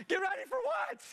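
very high, panicked shouted question; re-enacted squawk of alarm; freaking out. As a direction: throw it back in frantic disbelief. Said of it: "I'm freaking out".

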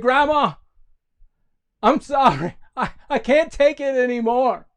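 high, loud, cracking-under-pressure outburst; desperate confession. Acted. Johnny finally confessing to Grandma, high desperate cry. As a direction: wail an apology in acted despair.

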